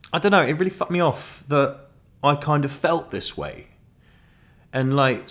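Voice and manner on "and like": ordinary talk, nothing marked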